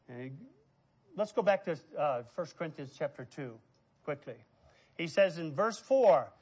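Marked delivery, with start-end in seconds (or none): none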